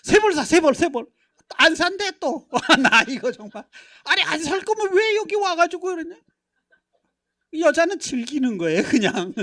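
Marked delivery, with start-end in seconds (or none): 0.0-1.1 louder, higher, fed-up husband barking; short-tempered husband in a department store, irritated; exasperated order. Retold or imitated. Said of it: "내가 승질 나가지고". 4.0-6.2 higher, louder, comic grumble; hammed-up annoyed complaint; mock exasperation. Grumbling husband at the store.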